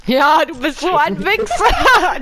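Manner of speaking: loud high slow angry yell; berserk rage. Real, not acted. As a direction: roar the insult out. Teammate betrays, gamer rages.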